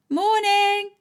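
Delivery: put-on high cheery girl voice calling in; high playful greeting. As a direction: call a bright morning through the door. Florrie calls through door.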